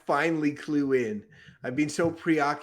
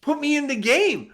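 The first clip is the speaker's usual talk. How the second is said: high, loud, eager yes; 10 semitones higher, 6 dB louder than usual. Acted. Son eagerly accepting his father's job, high and loud. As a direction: jump at the chance, all keen.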